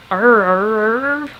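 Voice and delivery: "R" drawn out with a hard rhotic r sound; pronounced R. Shown as a demonstration. Demonstrating rhotic accents to viewers, "R" with the r fully sounded. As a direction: hold a long, strongly curled "R". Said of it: "Accents that pronounce R's".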